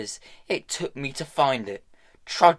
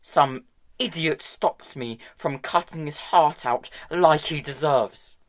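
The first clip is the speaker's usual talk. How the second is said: higher, louder bitter tirade; angry rant; 5 semitones higher, 6 dB louder than usual. A narrator's reading. Hester raging at Tom about Valentine, angry rant. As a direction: vent her fury at him.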